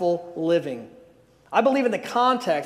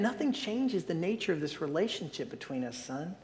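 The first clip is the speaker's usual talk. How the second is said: tender reassurance; 3 semitones lower, 11 dB quieter than usual. Acted. Father reassures crying son.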